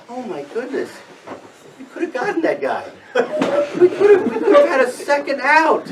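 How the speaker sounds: high exasperated lament, half laughing; retold dismay. Player at first base saying to himself, high-pitched dismay.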